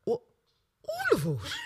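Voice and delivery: high, startled Brummie question; shocked Brummie. Retold or imitated. Bobby Beale shocked at the driver, high Brummie question. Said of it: "Like, shocked".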